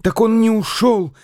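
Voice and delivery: high, eager cry of discovery; excited exclamation. A narrator's reading. The boy spots the man again, crying out excited. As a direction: burst out, jumping up. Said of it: "воскликнул, срываясь с места".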